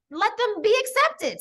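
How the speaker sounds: ordinary talk, nothing marked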